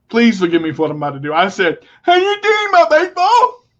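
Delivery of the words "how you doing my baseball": high, loud and over-the-top; manic mock greeting. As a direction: blurt it like a switch just flipped. Switch flips, he mocks the lady.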